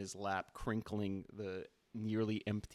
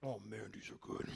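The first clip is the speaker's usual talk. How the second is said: whispered aside with a mouthful; whispering; 6 dB quieter than usual. Acted. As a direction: murmur it to yourself. Boss savouring the chips.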